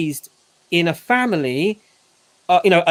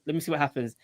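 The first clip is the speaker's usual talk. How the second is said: quiet aside; 5 semitones lower, 11 dB quieter, faster than usual. Retold or imitated. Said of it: "I just said".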